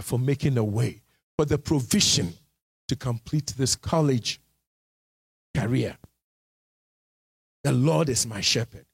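ordinary talk

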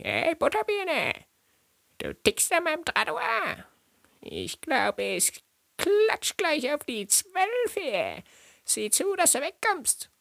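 high, exaggerated German dub voice; hammed-up voice actor; dubbed tough guy. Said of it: "so synchronisiert, sowas wie".

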